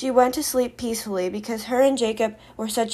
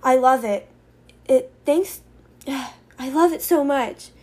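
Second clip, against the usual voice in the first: higher, heartfelt gushing; overjoyed gratitude; 4 semitones higher, 4 dB louder, slower than usual. Acted. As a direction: gush thanks to a dear friend. Grateful friend gushes over hearing.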